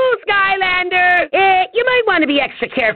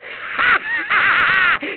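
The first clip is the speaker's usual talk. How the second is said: evil cackle; 4 semitones higher than usual. Acted. Villain gloats.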